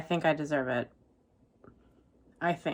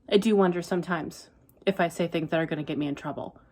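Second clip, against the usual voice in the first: ordinary talk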